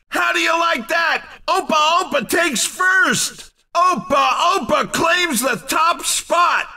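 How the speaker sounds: high-pitched, punchy victory commentary; excited winner call. Acted. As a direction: hype up the race winner. Announcer celebrates a first place.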